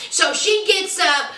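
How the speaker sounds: ordinary talk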